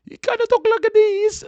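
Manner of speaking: squeaky high-pitched imitation of an old man; mock the odd neighbor's strange voice; loud, squealing impression of a weird old neighbor. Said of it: "the weirdest accent".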